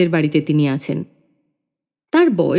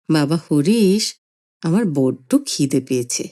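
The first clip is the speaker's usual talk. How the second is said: put-on slow, lower, whining old man; hungry old grandfather; 3 semitones lower than usual.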